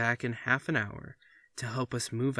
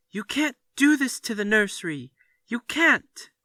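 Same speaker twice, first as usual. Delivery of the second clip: high, loud hysterical scream; children screaming in hysterics at their father; 12 semitones higher, 11 dB louder than usual. Acted. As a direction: shriek it like a child in a tantrum. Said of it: "They screamed and pranced".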